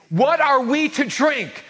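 hammed-up whining demand; mock demanding grumble. Grumbling crowd demands water.

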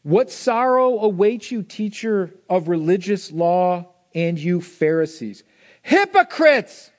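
slow, high, loud rebuke of the Pharisees; Jesus scolding the Pharisees, loud and slow; Jesus rebuking. Acted. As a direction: thunder it like a prophet, building to a cry on Hypocrites. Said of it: "This is what he says to them".

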